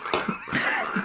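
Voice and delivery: squeaky breathless laugh; high wheezing laugh. Real, not acted. Laughing fit.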